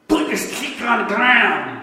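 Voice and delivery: loud, high, heavily slurred 'Put the stick on the ground'; slurred speech. Retold or imitated. Preacher with MS quoting God, loud slurred voice. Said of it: "That's how he talked".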